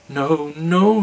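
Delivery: high, loud cry of refusal; the giant's wife cries out no; cried out. Acted. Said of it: "she cried".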